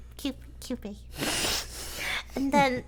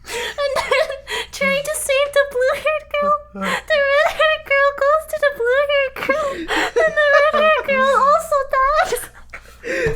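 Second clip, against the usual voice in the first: laughing while telling; 9 semitones higher, 4 dB louder than usual. Real, not acted. Telling sad plot, breaks up.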